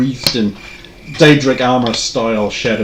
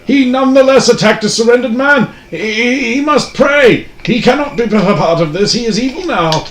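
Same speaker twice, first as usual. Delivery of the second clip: hammy character voice; 8 semitones higher than usual. Acted. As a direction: defy them like a pious martyr. Priest NPC condemning.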